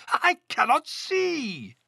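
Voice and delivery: high, slow theatrical wail; hammed-up storybook despair; distressed cry. A narrator's reading. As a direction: wail helplessly, stretching every word. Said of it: "cried Toad".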